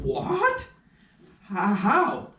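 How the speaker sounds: a sudden high cry of astonishment; jolted by the news; startled disbelief; shocked surprise. Acted. Told his lost nose was found.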